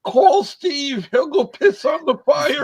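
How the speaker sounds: high mock shout, way up; joking high call-out. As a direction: call it out high and loud like a joke. Joking, calls out for Steve.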